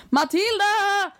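high, drawn-out mock scream for help; imitated scream. Girl left with stranger cries for friend, mock scream. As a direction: call out the name high and long. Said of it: "jag skriker".

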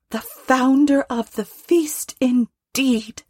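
high, drawn-out wifely scorn; high-pitched voice of an affronted wife; indignant Mrs Cratchit. A narrator's reading. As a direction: throw the toast back, high and indignant. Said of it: "cried Missus Cratchit, reddening".

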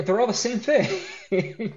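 laughing through the words; cracking up mid-sentence. Real, not acted. Laughing at identical designs.

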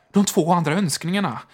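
quick reply. A narrator's reading. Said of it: "svarade hon snabbt".

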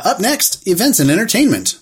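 hammy vintage radio announcer; old-timey radio host. Pretend. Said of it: "sound like an old-timey radio host".

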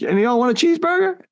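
high, fast, put-on taunting voice; mocking O-Dog. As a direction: fling it out high and fast like a cartoon. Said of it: "He's like".

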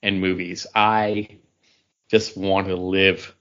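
ordinary talk

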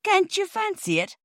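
high-pitched cockney sarcasm; indignant. A narrator's reading. Porter answering the children, high and indignant. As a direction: shoot it up in offended disbelief. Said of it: "said Perks indignantly".

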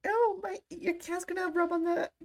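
very high delighted squeal; excited squeal. Real, not acted. Squealing at cat on camera.

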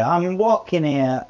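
exaggerated Brooklyn accent. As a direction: Brooklyn tough-guy accent. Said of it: "in his, uh, classic, uh, Brooklyn accent".

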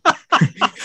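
a sudden high burst of laughter. Real, not acted. Guest telling the horse story, high laugh. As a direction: laugh out loud at your own story.

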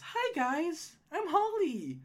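high-pitched gnome woman; Holly. Acted. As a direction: introduce yourself in a high gnome voice. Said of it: "I'm gonna voice her 'cause she sounds like this".

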